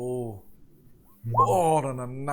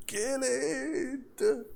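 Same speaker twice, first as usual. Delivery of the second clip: hammed-up high-pitched command; mock scream; 12 semitones higher than usual. Acolyte pointing at the skeleton thing, mock screaming. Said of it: "The Acolyte screaming".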